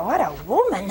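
ordinary delivery

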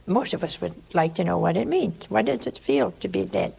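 normal talking voice

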